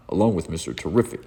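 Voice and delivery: usual speaking voice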